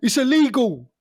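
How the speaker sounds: high, raving studio pundit; pundit impression. Pundit on BT raving about Ronaldo's goal. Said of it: "the spoil was in the studio".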